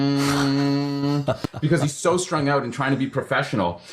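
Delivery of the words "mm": long, steady buzzing hum; electric shaver hum. Retold or imitated. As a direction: hum like an electric razor, held on one note.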